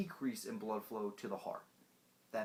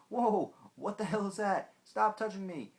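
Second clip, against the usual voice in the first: higher, louder outraged voice; upset diaphragm; 9 semitones higher, 8 dB louder than usual. Acted. The diaphragm's nerves yelling at the stomach. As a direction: shriek like a touched diaphragm. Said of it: "the nerves in your diaphragm go".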